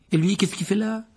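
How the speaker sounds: high-pitched, questioning; high questioning lilt; puzzled question. Acted. Ron questions Harry, acted in story.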